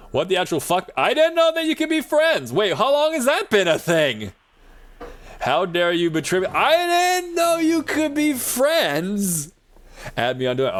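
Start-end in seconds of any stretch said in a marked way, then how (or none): 0.1-4.3 high, loud astonished outburst; stunned, pitch shooting up; shocked disbelief. Real, not acted. Shocked by friend feature she missed. 5.4-9.5 high, drawn-out cry of feigned betrayal; hammed-up wounded protest; mock outrage. Mock betrayal over Duolingo friends.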